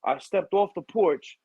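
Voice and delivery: high, worked-up storytelling; excited retelling. Retold or imitated. Telling the boss about the kid jumping off the deck, high and excited. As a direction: pitch up, tell it like you can't believe it. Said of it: "I'm like".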